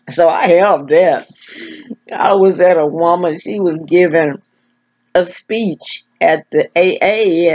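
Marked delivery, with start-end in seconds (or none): none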